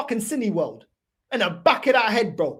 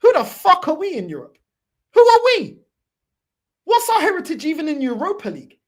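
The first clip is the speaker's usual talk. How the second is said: shouted, higher and much louder; angry shout; 6 semitones higher, 6 dB louder than usual. Real, not acted. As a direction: yell it at the fanbase in disbelief. Angry rant at own fanbase.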